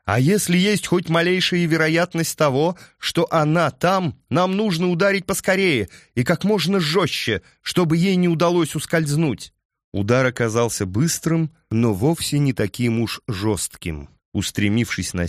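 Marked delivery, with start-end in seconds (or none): 0.0-9.5 higher, raised voice at a gallop; shouting over wind. A narrator's reading. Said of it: "прокричала в ответ Аллерия".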